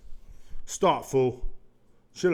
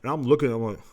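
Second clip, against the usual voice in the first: ordinary talk, nothing marked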